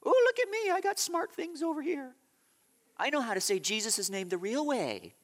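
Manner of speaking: high, smug mock voice of a know-it-all. Mocking the people who insist on saying Yeshua. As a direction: sneer like someone showing off their cleverness. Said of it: "it's a sideshow".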